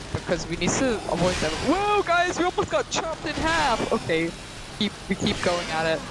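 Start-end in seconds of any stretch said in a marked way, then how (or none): 1.7-3.9 high-pitched playful panic; startled alarm. Pretend. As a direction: squeal it as a near miss. Startled by near miss in game.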